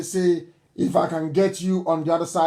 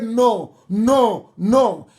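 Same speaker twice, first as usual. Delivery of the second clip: firm repeated no; 3 semitones higher, 4 dB louder, much slower than usual. Real, not acted. Forbidding donations to IPOB.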